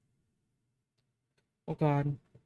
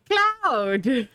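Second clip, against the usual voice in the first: louder, high shout of the right word; emphatic correction; 6 semitones higher, 6 dB louder than usual. Real, not acted. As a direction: snap back to the correct word. Correcting clown to cloud.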